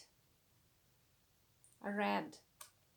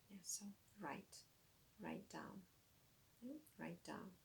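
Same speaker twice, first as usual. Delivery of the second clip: quiet; 14 dB quieter than usual. Real, not acted. Quiet classroom instruction.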